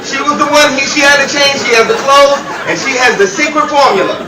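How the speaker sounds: high, loud frantic accusation; panicked screaming. Acted. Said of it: "your screaming".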